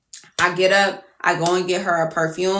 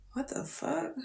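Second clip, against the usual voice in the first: very soft, higher-pitched puzzlement; quiet disbelief; 7 semitones higher, 16 dB quieter than usual. Retold or imitated. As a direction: barely voice your baffled reaction. Acted baffled reaction, watch missing.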